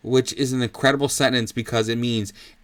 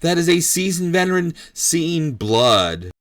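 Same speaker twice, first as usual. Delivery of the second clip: loud, admiring ringside outburst; fight commentator; 5 semitones higher, 4 dB louder than usual. Acted. Commentator admiring Bob's chandeliers line, excited play-by-play.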